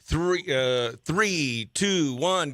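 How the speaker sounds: ordinary delivery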